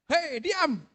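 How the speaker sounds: short, high-pitched hushing call; scolding teacher. Acted. Teacher silencing a noisy class, scolding. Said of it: "Di kelas juga sama".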